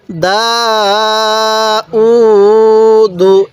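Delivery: দা and উ held long, দু short; one-alif elongation. Shown as a demonstration. Teacher showing how to read it, drawn-out দা উ, short দু. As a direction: stretch দা, stretch উ, then close briefly on দু. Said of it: "এরকমভাবে শিখব".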